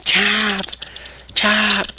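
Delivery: high, urgent demand to tap. Retold or imitated. Punk yelling at Austin to submit, urgent demand. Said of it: "C M Punk is like".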